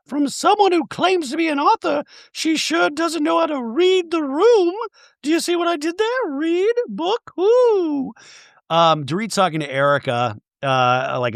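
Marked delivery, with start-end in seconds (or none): 0.1-8.1 Dorit impression. Dorit in the Talking Head.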